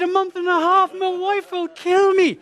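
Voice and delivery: high, loud panicked wail. Retold or imitated. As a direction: wail it as if your life is over. Groom panicking at sea.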